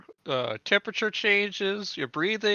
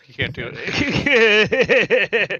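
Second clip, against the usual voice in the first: slow, booming divine voice; voice of God; 2 semitones lower, 4 dB louder, slower than usual. Acted. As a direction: speak slowly like an all-powerful god. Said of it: "a voice of God has told me otherwise".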